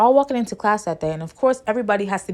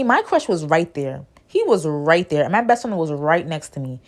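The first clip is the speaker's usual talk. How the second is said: ordinary delivery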